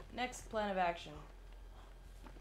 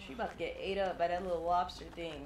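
ordinary talk

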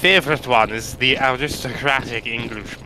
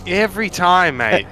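put-on Australian accent; 6 semitones higher, 5 dB louder than usual. Riffing on the Aussie bit.

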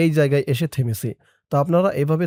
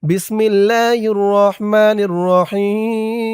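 slow, drawn-out Quranic chant; slow recitation; 4 semitones higher, 4 dB louder, much slower than usual. Shown as a demonstration. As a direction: recite it slowly with tajweed. Recites Arabic slowly. (ধীর তিলাওয়াতের সুরে)

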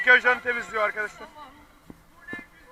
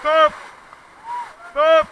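shouted command; 6 dB louder than usual. Real, not acted. Rafting guide calling commands.

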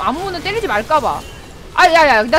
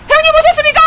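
much higher, louder hammed-up lackey greeting; bow and bellow to the big boss; mock gangster greeting; 10 dB louder, much faster than usual. Gamer greets an arriving ally like a thug's boss.

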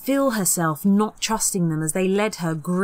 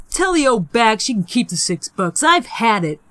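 louder, dismissive; fed up; 4 semitones higher, 6 dB louder than usual. Acted. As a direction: wave it all off. Peter and Sarah quitting.

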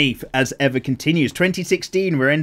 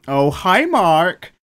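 louder, hammed-up cheery hello; imitating the film guy; 5 semitones higher, 6 dB louder, much slower than usual. Quoting The Room to viewers, a louder hammed-up hello. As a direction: deliver the famous bad-movie greeting. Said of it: "And he just goes".

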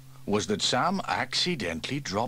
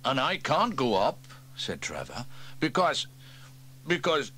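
higher, stalling and hesitant; stalling fireman; 5 semitones higher than usual. Acted. Trevor stalls for an excuse, hesitantly. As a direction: hunt for an excuse.

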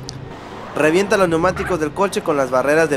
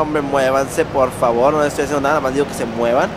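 usual speaking voice

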